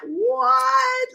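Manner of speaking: a high squeak of disbelief; recreating her jaw-drop moment; stunned what. Retold or imitated. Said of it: "that was one of those things where I went".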